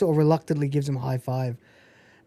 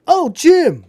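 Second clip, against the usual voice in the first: higher, louder startled greeting; mock surprise; 9 semitones higher, 7 dB louder than usual. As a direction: caught off guard at the door. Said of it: "he goes".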